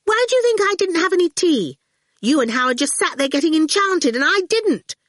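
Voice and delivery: put-on high, fast accusing kid; bratty little girl. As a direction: snap it like a smug child. Awful continues accusing.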